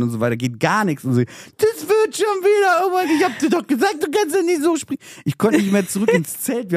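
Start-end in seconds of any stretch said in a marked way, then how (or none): 1.2-5.0 ape her sing-song comfort from way up high; mocking girlfriend. Imitating his girlfriend consoling him, high and mocking.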